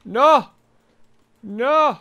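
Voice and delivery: panicked yells of no; desperate gamer screaming for help; panicking gamer. Real, not acted. Killed by players, yelling for shield.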